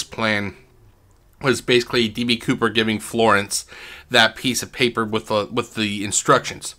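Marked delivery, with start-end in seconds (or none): none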